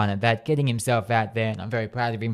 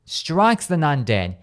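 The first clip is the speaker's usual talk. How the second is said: normal talking voice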